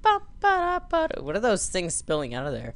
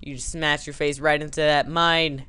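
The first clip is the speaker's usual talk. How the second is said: ordinary talk, nothing marked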